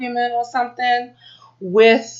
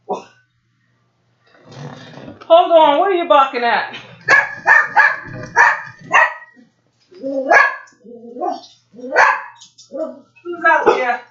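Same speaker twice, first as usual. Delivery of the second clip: calling into the dark; 6 semitones higher than usual. Real, not acted. Hears dog barking outside.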